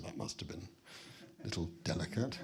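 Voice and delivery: low, soft, tactful understatement; British understatement. Retold or imitated. Guest reacting to her marriage story, quietly understated. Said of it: "Sort of British understatement".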